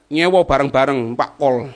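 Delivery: usual speaking voice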